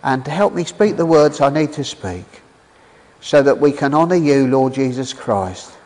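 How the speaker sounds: low, hushed prayer voice; preacher praying for help before the sermon, low and hushed; earnest prayer. Real, not acted.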